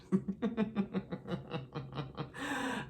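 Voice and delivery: high, breathy burst of laughter; long giggle that trails off; real laugh. Real, not acted. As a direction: laugh at your own dark joke. Laughs at her own joke.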